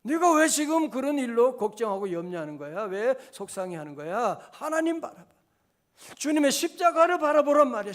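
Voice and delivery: loud pleading rebuke; self-scolding. Retold or imitated. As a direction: shake yourself, rising loud. Pastor scolds himself in quote.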